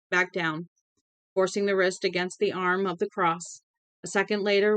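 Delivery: ordinary delivery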